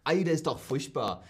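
Hessian accent slipping toward Swabian; Hessian attempt. Shown as a demonstration. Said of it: "Immer wenn ich Hessisch rede". (hessisch-schwäbischer Mischakzent)

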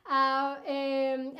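ordinary delivery

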